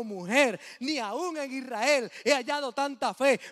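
loud, slow, solemn pronouncement; Jesus praising the woman's faith, booming; Jesus proclaiming. Acted. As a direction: declare it with full authority. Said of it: "Jesús la miró y le dijo".

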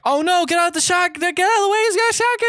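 very high, squealed warning; mock panic. Driver warns friend of a shotgun, mock panic.

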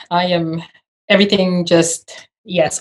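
usual speaking voice

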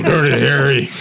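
ordinary delivery

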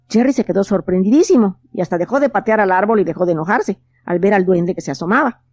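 usual speaking voice

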